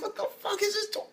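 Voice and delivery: very high, laughing, incredulous squeal; laughing disbelief. Retold or imitated. Reacting to the weird anime villain, high laughing disbelief. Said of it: "I'm like".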